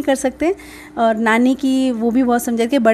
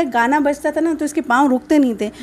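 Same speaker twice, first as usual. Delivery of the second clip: ordinary talk, nothing marked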